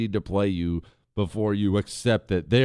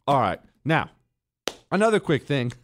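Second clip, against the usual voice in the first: ordinary delivery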